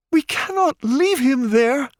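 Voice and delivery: Paige pleading. A narrator's reading. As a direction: plead to go back for him. Paige protests being dragged off.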